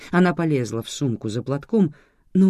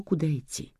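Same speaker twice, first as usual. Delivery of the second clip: plain reading voice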